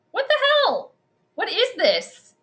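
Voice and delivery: real alarm at a frozen screen; baffled. Real, not acted. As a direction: squeal out your disbelief. Frozen on live stream.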